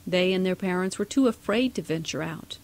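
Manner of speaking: ordinary delivery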